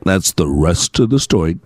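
low, deep radio-announcer sign-off; Paul Harvey impression. A host quoting Paul Harvey's famous radio sign-off, in a low announcer voice. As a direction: drop into a classic broadcaster's voice to close the tale. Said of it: "as Paul Harvey would say".

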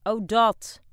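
loud, high, dismissive; loud old wife. Acted. Deaf old wife loudly dismisses the question. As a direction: call it out carelessly.

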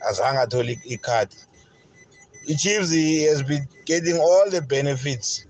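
ordinary talk, nothing marked

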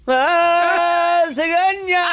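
donkey bray. Pretend. Host showing the donkey sound a therapist may hear. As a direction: bray like a donkey through the door. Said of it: "it's more than likely me".

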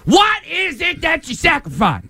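high, slow, hammered-out question; mock interrogation. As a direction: belt it out like an over-dramatic interviewer. Mocking a theatrical interview question.

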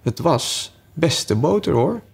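slow, high, rising insistence; repeated feeble excuse. A narrator's reading. March Hare with nothing better to say, slow lilting insistence. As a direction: draw it out and lift it at the end. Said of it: "op iets beters dan zijn eerste opmerking kwam die niet".

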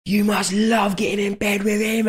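put-on high, leering drunk fan; mock the pushy drunk's voice; drunk lad. Drunk fan tells the girlfriend this, high and leering. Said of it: "he was going to my girlfriend".

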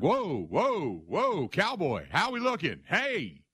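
drawn-out, rising whoa to rein him in; slow him down like a spooked horse; whoa cowboy. Retold or imitated. Host calming a panicking Packers fan: drawn-out whoa cowboy.

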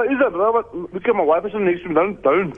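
normal talking voice

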